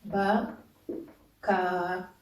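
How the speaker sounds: "ba, ka" in two parts, the "ka" held long; syllable-by-syllable reading. Shown as a demonstration. Teacher reading the example for learners, "ba, ka" split with a long final a. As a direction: separate "ba" from "ka" and drag out the a of "ka". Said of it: "on va lire ici".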